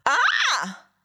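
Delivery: high acted shriek of fright; cri d'effroi. A narrator's reading. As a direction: let out a high cry of dread. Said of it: "elle pousse un cri d'effroi". (cri d'effroi aigu)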